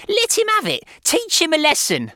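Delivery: very high, loud screech; excited, bloodthirsty shrieking. A narrator's reading. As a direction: egg him on at a shriek. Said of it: "she was shrieking".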